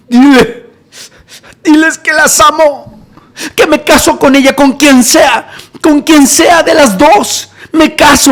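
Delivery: high, loud, drawn-out wail; imitated crying plea. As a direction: declare love in a hammed-up sob. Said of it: "empieza a llorar y dile".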